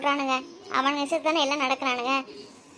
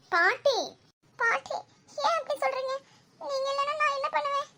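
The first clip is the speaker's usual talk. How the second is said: high-pitched, upset appeal; distressed pleading; 10 semitones higher than usual. Acted. Grandchild begging grandmother not to talk of dying, distressed pleading.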